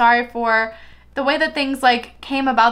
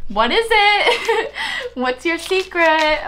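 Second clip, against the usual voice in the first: high, drawn-out teasing question; playfully prying; 6 semitones higher, much slower than usual. Acted. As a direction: coax out a secret in a slow singsong. Playful reply to card's secret.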